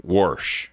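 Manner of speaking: wash said as "Warsh", with an r; drawn-out "Warsh", r before the sh; regional "Warsh". Retold or imitated. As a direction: lean slowly on the r in "Warsh". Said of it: "That's how number three says it".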